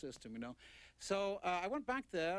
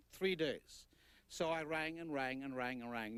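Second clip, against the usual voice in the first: ordinary talk, nothing marked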